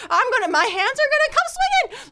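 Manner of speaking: ready to fight for her friends; fired up. Real, not acted. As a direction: pump yourself up for a brawl. Imagining a fight.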